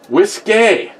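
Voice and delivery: high announcing call. Real, not acted. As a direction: sing it out like an announcement. Calling out the drink's name.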